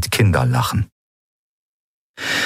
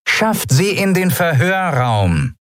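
much higher, slow voice through a crackling speaker; the captain orders the guards over the speaker; Zaylar over loudspeaker; 10 semitones higher, slower than usual. Acted. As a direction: command from a distant loudspeaker. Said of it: "aus einem Lautsprecher".

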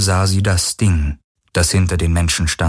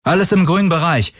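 calling out; 10 semitones higher than usual. A narrator's reading. Garland calls to Kirai from inside the ship, calling out. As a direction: call the all-clear to someone outside. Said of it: "rief Garland".

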